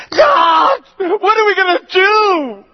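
high, loud panicked wail; desperate cry. Acted. People cry out in crisis.

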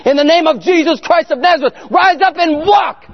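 high, loud commanding shout, slowing at the end; forceful prayer shout. Retold or imitated. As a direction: bellow the healing command with all your force. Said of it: "I just doing it so hard".

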